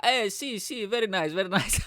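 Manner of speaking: high, cheery mock Spanish voice; imitated clueless Spaniard. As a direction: agree happily without understanding a word. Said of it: "didn't speak a word of English".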